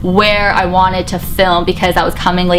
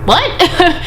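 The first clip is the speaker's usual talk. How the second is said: surprised what; 9 semitones higher than usual. Retold or imitated. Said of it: "I was like".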